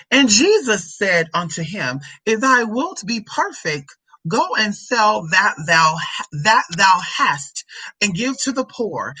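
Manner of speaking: slow, declamatory Bible reading; scripture reading. Acted. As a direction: read the verse out grandly, drawing out key words. Reads scripture aloud.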